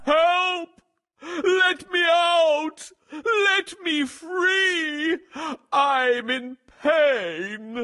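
high, drawn-out cries for help; roaring and crying. A narrator's reading. Lion trapped in ropes calling for help, anguished wail. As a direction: wail it out slowly, desperate to get free. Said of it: "his roaring and crying".

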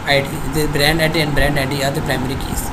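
ordinary talk

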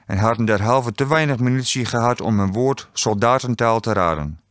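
plain reading voice

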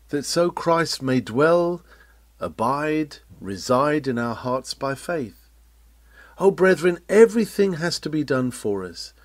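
ordinary talk

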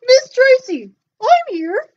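a bouncy little puppet popping up. Pretend. Puppet Joe greets teacher.

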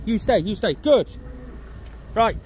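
ordinary talk

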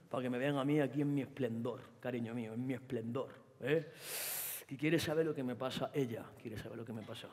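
put-on low, soft sarcastic boyfriend voice; mocking boyfriend. Boyfriend mocking his girlfriend in the scene, sarcastic. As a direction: sneer at her quietly.

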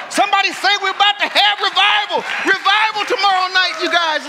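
loud, high revival cry; excited shout. Real, not acted. Preacher whipping up the crowd for tomorrow's revival, loud shout.

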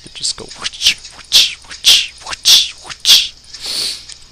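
airy whispered whoosh sounds for each knife swing. Shown as a demonstration. Showing a friend the knife swing, whispered whoosh. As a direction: breathe out a whoosh per swing.

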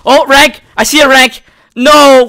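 high, loud shouts, hope turning to dismay; excited shouting. Real, not acted. Gamer who sees a rank in the crate draw, excited shouting. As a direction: yell in real excitement, then cry out in disappointment.

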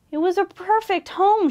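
high, sad little voice; understated storybook sadness; mildly sniffly. A narrator's reading. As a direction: lament it with a touch of tears. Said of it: "she sniffled".

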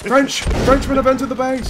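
high, loud warning cry; hammed-up sentry raising the alarm; alarm shout. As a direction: yell that the enemy is inside.

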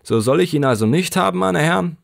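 high, quick plaintive haggling; Gamfield asking. A narrator's reading. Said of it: "fragte Gamfield".

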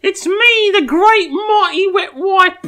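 squeaky, loud cockney wet wipe monster; hammed-up high cockney British accent; Tom Holland British. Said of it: "Tom Holland British".

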